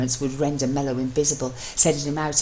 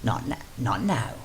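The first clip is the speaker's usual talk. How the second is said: gruff; 4 semitones lower, 4 dB quieter than usual. Acted. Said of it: "Mello gruffly told him".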